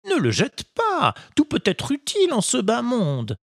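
put-on a patient old woodcarver advising his boy; kindly old Geppetto. Geppetto voiced in story.

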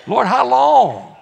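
loud, high, drawn-out wail; hammed-up plea to heaven; mock protest. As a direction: cry out slowly in exaggerated dismay. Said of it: "this is how I know he's Baptist".

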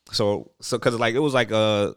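usual speaking voice

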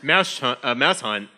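talking through a mask; words muffled behind a mask. Real, not acted. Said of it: "It's hard to talk with this mask on".

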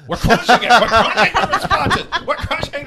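loud, laughing brag; laughing shout. Shown as a demonstration. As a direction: yell it out through laughter. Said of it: "repeats phrase louder, laughing".

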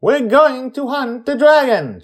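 put-on high loud kid declaration; eager boy. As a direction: announce it like an excited boy. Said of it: "Charlie says".

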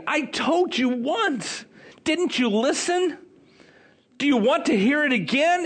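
high-pitched, sarcastic questioning; mocking exasperation. Healed man pressed to repeat his story, mocking them. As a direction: make fun of them for not listening. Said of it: "it's like he's making fun of him".

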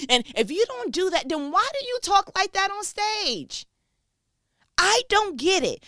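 high, loud, baffled protest; exasperated. Real, not acted. Challenging pastors who talk differently on stage, exasperated. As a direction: push it up high and loud in disbelief.

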